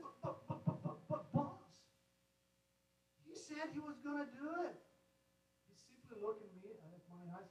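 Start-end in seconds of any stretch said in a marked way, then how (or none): 0.0-1.8 stammering protest. Retold or imitated. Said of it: "I said".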